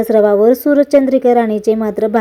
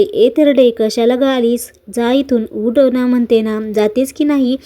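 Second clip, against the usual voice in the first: ordinary delivery, nothing marked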